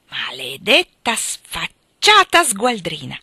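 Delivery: loud, very slow savage yell; furious insult. A narrator's reading. Drunk husband shouts an insult at his wife, loud and slow. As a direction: spit each word out at her in rage. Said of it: "le ha gridato".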